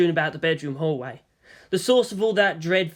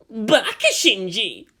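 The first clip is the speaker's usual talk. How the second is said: muttering; 5 semitones higher, 4 dB louder, slower than usual. A narrator's reading. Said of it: "Asuka muttered".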